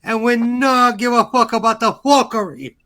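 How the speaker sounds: put-on Jamaican lilt; Jamaican accent. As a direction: ham up a mock Jamaican accent. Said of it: "he'd sound Jamaican".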